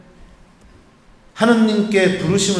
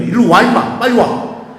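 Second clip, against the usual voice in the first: louder, bossy come-here call; gruff insistence; 2 semitones higher, 5 dB louder than usual. Retold or imitated. Priest bossily pulls the seminarian away, loud. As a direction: order him over like an older brother.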